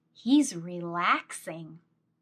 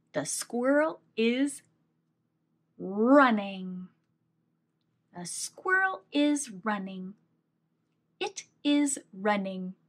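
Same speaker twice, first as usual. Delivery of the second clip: ordinary talk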